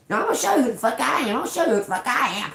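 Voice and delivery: mimicking the lady's fast, shrill-sounding threat; imitating the maintenance lady. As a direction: rattle it off like a yappy little dog. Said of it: "They started cussing me".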